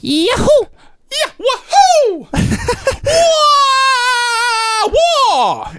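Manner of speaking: very high cartoon whoops; long falsetto yells; Mario. Shown as a demonstration. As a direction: whoop it like a video-game plumber. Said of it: "I got a Mario".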